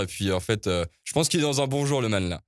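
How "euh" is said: ordinary talk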